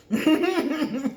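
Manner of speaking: high burst of laughter; loud laugh. Real, not acted. Laughs at her own joke.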